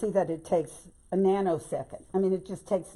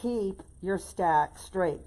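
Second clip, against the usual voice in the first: slow emphasis; much slower than usual. Shown as a demonstration. Crafter repeating her golden rule to viewers, very slow. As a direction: keep your stack straight, drawn out slowly word by word. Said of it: "I always emphasize that".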